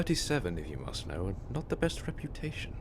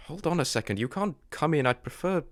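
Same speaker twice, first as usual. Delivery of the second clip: ordinary talk, nothing marked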